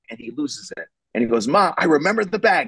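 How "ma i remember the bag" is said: imitating an exasperated son losing it; higher-pitched, half-yelled retort to his mother; mild mock yell. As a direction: act out losing patience with Ma. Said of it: "he starts yelling at her".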